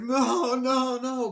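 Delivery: very high, loud drawn-out wail; re-enacted desperate cry; imitated girl's screaming plea. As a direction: wail in mock terror. Acts out girl pleading.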